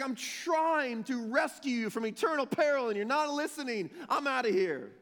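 high-pitched exasperated outburst; acted frustration, voice pushed up high; frustrated. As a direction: plead in exasperation, then give up. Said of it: "He's just frustrated, man".

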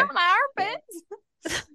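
high, slow squealing plea; mock tickled plea. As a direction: beg like you're being tickled. Playing the tickled victim.

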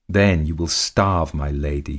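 plain reading voice

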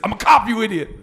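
high, loud Austrian action-star outburst; Arnold Schwarzenegger. Retold or imitated. Soundboard clip yelled at a confused callee, loud Austrian accent. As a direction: bellow it in a comic Austrian accent. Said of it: "the other sound clip was".

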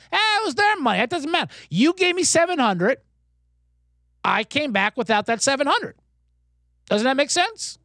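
high-pitched mock protest; exasperated arguing. Gambler dad defends losing his son's money, acted exasperation. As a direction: argue it back as the exasperated gambler.

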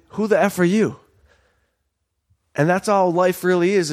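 ordinary delivery

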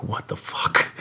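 a hushed, amazed what the fuck; whispered awe. Retold or imitated. Staring at the living room ceiling, whispered awe. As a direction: breathe it out in wonder.

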